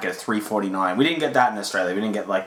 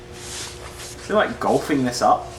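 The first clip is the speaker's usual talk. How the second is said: ordinary talk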